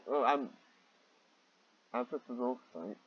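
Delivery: ordinary delivery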